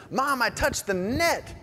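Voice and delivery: high, drawn-out triumphant cry; excited kid imitation. Quoting the rapper's boyhood triumph to Mom, excited imitation. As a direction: mimic a boy crowing to Mom.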